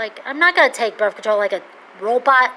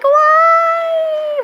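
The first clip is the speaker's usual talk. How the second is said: sudden high, loud cry of why; exasperated shout; 3 dB louder than usual. Retold or imitated. Complaining about her soy allergy with a high shout. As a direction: yell it up to the sky in disbelief. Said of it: "and I'm like".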